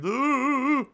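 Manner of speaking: loud, drawn-out high "ooh"; high mock wailing ooh. Streamer acts out boxes spilling everywhere, high wailing. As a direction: wail one long, high ooh.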